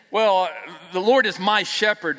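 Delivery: "Well, the Lord is my shepherd" said smug and loud; possessive mock tone. Shown as a demonstration. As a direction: sound like you own him. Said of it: "like I might have some kind of say".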